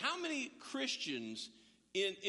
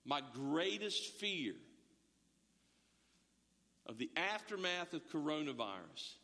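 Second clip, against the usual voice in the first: ordinary talk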